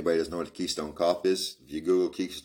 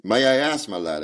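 plain reading voice

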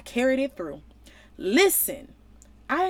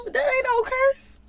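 exclaim; 14 semitones higher than usual. Real, not acted. Repeated exclamation, higher.

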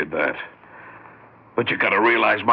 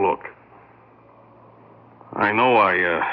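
ordinary talk

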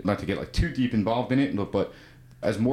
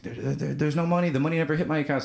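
frantic panic; 7 semitones higher, faster than usual. Retold or imitated. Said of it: "the guy calls me in a frantic panic".

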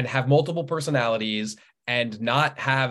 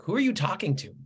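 a light, higher-pitched puzzled wife; imitating wife; 8 semitones higher than usual. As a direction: ask it like the puzzled wife overhearing. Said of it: "she's like".

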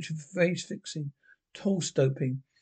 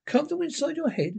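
laughing; 10 semitones higher, faster than usual. Acted. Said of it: "Excuse me for laughing".

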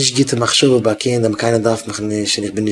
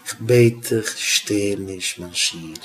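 gentle plea; 2 semitones lower, 5 dB quieter, slower than usual. Retold or imitated. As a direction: plead softly with a pupil, unhurried. Retells gentle plea to pupil. (א שטילע, ווייכע בקשה)

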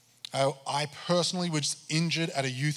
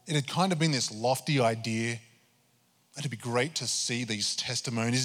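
ordinary talk

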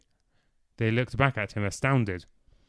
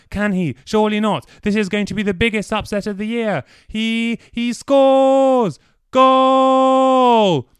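excited football commentator, higher and louder, building to a yell; sports commentator; 9 semitones higher, 8 dB louder than usual. Acted. Dorian mocks Clive's win as a sports commentator, rising to a frenzy. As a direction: call the goal like a live match commentator. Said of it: "the impersonations he had just managed".

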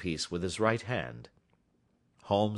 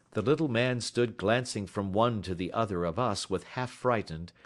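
usual speaking voice

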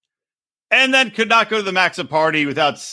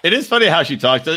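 normal talking voice

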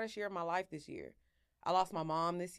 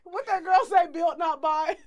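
loud; 9 semitones higher, 10 dB louder than usual. Real, not acted. Loud laughing quote.

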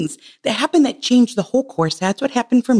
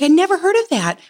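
incredulous protest; 6 semitones higher than usual. Retold or imitated. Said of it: "I'm like".

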